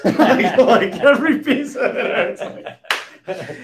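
laughing through the words, slow and high; can barely get it out for laughing; real laughter while retelling the line. Real, not acted. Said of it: "It's so great".

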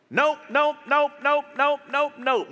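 rapid-fire string of high nopes; emphatic denial. Acted. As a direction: knock down every suggestion, high and insistent. Answers his own mock questions.

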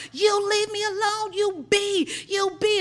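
high, drawn-out mock yell; retold yelling. Caught shoplifter yells at the store manager, retold high yell. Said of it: "I just hear her yelling out".

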